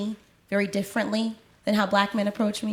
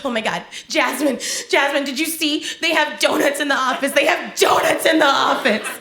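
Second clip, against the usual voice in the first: mock frantic coworker; 4 semitones higher, 5 dB louder than usual. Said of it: "She's like".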